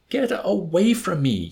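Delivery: high, slow, drawn-out plea; pleading. A narrator's reading. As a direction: beg loudly to be left alone. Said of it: "Yossarian answered, pleading with him now".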